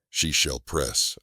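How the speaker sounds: plain reading voice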